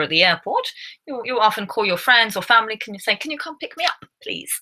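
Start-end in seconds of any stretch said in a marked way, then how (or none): 3.2-4.5 soft, high-pitched mock plea for a favour; pleading request. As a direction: ask sweetly and softly, lifting the pitch. Acts out phone request to friends.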